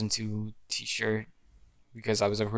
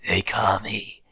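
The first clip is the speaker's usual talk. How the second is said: low, breathy monster whisper sinking lower; Venom voice; 3 semitones lower, 7 dB louder, slower than usual. Retold or imitated. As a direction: hiss the name like a menacing symbiote. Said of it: "[name]'s like".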